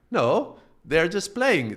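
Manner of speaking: high, slow, drawn-out dismissal; amused reassurance. Retold or imitated. Telling a worried friend it is only a game, acted reassurance.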